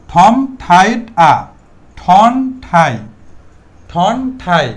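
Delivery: slow, loud, word-by-word demonstration; emphatic teacher's model, stretched and deliberate; careful correct pronunciation. Shown as a demonstration. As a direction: separate each word with a clear pause. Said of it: "প্রথমে হচ্ছে শুদ্ধ উচ্চারণ".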